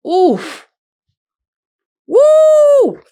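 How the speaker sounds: a sharp oof, then a loud, high whooping cheer; hype yell. Real, not acted. Vlogger cheering herself after a great line, loud high whoop. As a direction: whoop like you just dropped a killer line. Hyped by her own line.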